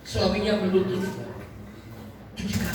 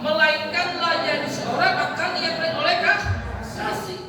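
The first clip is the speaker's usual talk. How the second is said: higher-pitched, impassioned sermon delivery; fervent preaching; 6 semitones higher than usual. Acted. Preacher urging couples to serve with love, fervent. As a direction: preach it with rising urgency.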